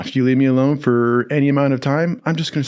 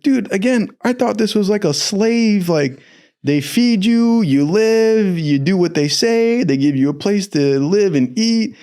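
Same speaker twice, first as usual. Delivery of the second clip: higher, eager rant about the deal; much higher, wound-up naive pitch; naive excited rant; 8 semitones higher, slower than usual. Retold or imitated. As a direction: talk it up like a clueless kid sold on a dream. Retold naive younger self.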